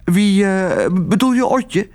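high, hesitant, flustered aunt; faltering high-pitched question; stammering. A narrator's reading. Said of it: "stamelde tante Mies".

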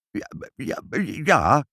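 nervous, broken reply; stammering. A narrator's reading. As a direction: stutter out a flustered yes. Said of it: "hakkelde meneer Pinkepank".

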